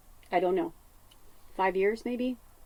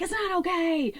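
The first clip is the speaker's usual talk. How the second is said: upset; 11 semitones higher than usual. Retold or imitated. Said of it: "she's like".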